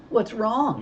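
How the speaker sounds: mock her with a sneer. A narrator's reading. Deputy sneers at the scared kid, sneering. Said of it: "she sneered".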